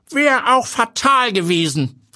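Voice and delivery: high, drawn-out grandpa voice; old grandpa. A narrator's reading. Grandpa remarking on the berry to the others, high old-man voice. Grandpa's character voice in narrated story.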